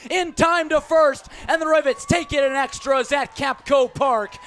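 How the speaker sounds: excited call. Real, not acted. Game-winning final out call.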